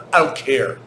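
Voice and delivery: normal talking voice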